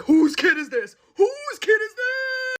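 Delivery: loud, incredulous cry, stretched and shooting up high; high dramatic disbelief; shocked outburst. Retold or imitated. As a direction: wail it in mock outrage. Acting out jaw-dropped shock at the child.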